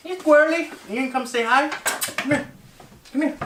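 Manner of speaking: high coaxing voice to a pet; sweet high call to a squirrel; pet talk. Real, not acted.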